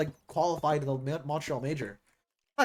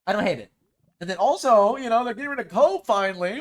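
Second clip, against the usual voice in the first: ordinary talk, nothing marked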